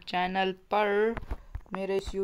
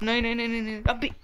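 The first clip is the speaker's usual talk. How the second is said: panicked cries; 4 semitones higher, faster than usual. Real, not acted. Gamer crying out as he is about to fall. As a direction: cry out in alarm to stop it.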